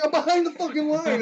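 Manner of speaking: high, loud frustrated protest; frustrated outburst. Retold or imitated. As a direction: insist angrily, getting fed up. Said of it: "I'm like".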